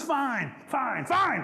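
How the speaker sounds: high, repeated petulant comebacks; huffy retort. Acted. Possum out-shouting Rabbit in a squabble, huffy. Possum gets the last word.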